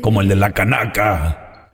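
very low, slow rasping demon; raspy devil. Acted. Devil appears to whoever summoned him, deep raspy voice. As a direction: growl it slowly from the depths. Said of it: "Qué voz tan rasposa".

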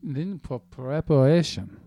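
slow, drawn-out recipe read. Acted. Dragging out the recipe to annoy the host, very slow. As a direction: stretch every word out deliberately. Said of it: "Could you read this any more slowly?".

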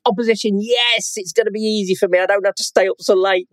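high-pitched, playful self-parody of excitement; mock delight. Acts out his delight on reading.

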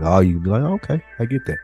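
usual speaking voice

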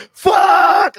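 sudden high, loud despairing shout; a hammed-up cry of regret; anguished yell. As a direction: scream it out in horror. Father realizes help arrived.

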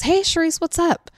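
lightly voiced husband, casual and unbothered; relaxed husband. Husband answering the phone, calm and casual. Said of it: "by the sound of his voice".

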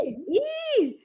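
high-pitched drawn Geordie "E". Shown as a demonstration. Geordie showing how sentences start, exaggerated Geordie. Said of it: "starts with E".

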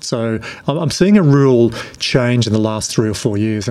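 ordinary delivery

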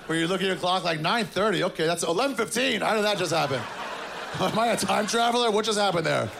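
high, giddy disbelief at the clock; amazed. Retold or imitated. Single guy checking the clock, high and amazed. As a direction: marvel at how time vanished. Marvelling at lost sleep time.

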